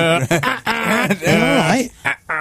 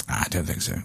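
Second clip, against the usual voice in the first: low, much softer mumble of a reluctant brother; mumbled brother refusal; 12 dB quieter than usual. Retold or imitated. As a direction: brother on the phone not keen, muttered low and soft.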